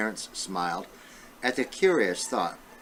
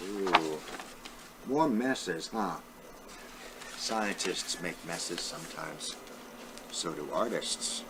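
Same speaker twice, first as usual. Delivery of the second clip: soft, lower aside to the class; teacher aside; 4 semitones lower, 6 dB quieter than usual. Real, not acted. Teacher pausing the story to chat softly with the kids.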